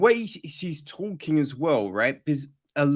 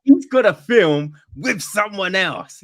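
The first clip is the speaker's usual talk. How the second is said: self-mocking; 5 semitones higher, 8 dB louder than usual. Real, not acted. Mocks own useless half-remembered news.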